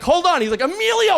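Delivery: high, loud call across the club. Retold or imitated. JJ calls Emilio over across the club, loud and high. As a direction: summon a friend over the music.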